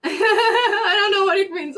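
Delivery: laughing through the words, high. Real, not acted. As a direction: giggle it out slowly. Laughs at French comment.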